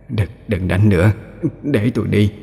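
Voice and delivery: weak, hushed begging; trembling plea. A narrator's reading. Beaten ghost begs the demon to spare her family, trembling plea. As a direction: plead softly, afraid for the family. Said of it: "cất giọng run rẩy van xin".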